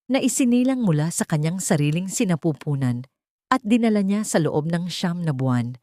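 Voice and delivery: plain reading voice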